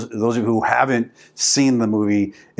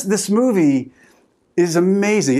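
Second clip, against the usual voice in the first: usual speaking voice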